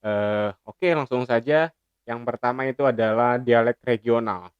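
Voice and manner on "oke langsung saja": ordinary talk, nothing marked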